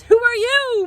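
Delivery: high, loud startled question; startled cry. Acted. Mother crying out at the child at the door, startled. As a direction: cry out at the stranger. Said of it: "my mother cries".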